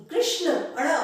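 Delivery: कृष्ण with a clear retroflex ण, tongue curled back; teacher giving the right form; correct retroflex Krishna. Shown as a demonstration. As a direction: stress the curled ण sound in कृष्ण. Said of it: "पर न नहीं".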